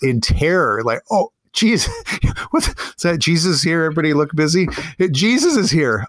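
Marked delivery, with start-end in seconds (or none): 1.1-6.0 high, hammed-up startled cry; mock panic. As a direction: play a caught-out slacker in comic alarm. Adam panics, comic acted quote.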